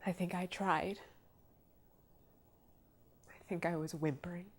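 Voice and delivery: small, soft voice, haltingly; subdued distress. Real, not acted. Woman recalling her own whimpering, quiet and halting. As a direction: trail down softly after long pauses. Recalling being unable to speak.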